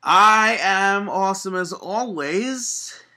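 loud, slow and proud; boastful. Real, not acted. Host answering his co-host with big boastful pride. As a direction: brag about it grandly.

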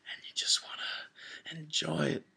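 sudden dramatic whisper. Pretend. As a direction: drop to a whisper mid-promo. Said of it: "cut a little promo for us real quick".

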